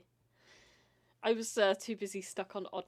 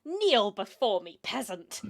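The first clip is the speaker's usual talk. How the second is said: booming arrogant alien overlord; 12 dB louder than usual. Acted. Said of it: "She will just yell at you".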